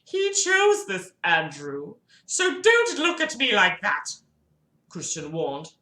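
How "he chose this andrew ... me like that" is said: much higher warning voice; high character voice. Acted. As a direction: warn him in a high put-on voice.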